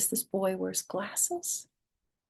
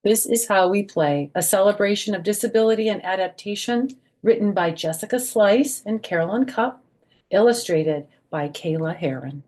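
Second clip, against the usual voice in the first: bright, slightly louder read-aloud of the title; 4 semitones higher, 7 dB louder than usual. A narrator's reading. Grandma announcing the picture book to kids, bright read-aloud.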